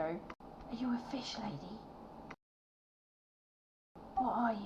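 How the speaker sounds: put-on breathless whispering kids; hushed, wide-eyed child asking in awe; curious children. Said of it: "look at you and go".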